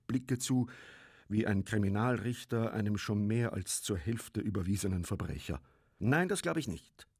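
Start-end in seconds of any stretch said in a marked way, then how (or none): 6.0-7.1 high, bold refusal; spirited, decided no; hearty, firm. A narrator's reading. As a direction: deny it stoutly. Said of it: "sagte Sepp herzhaft".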